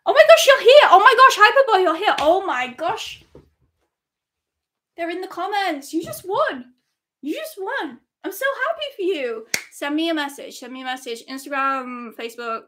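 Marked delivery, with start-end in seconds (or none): 0.0-2.1 high, loud squeal of joy; screaming happily at the winner; ecstatic shriek. Real, not acted. 2.2-9.5 giddy excitement. Real, not acted. Thrilled for winner.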